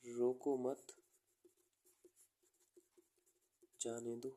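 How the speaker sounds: "रोको मत" together, long pause, then "जाने दो"; pause after मत. Shown as a demonstration. Teacher reading the first meaning, pause after मत. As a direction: break the phrase after मत. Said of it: "comma का मतलब क्या होता है? थोड़ा पॉज लो".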